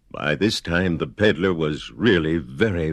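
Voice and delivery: ordinary delivery, nothing marked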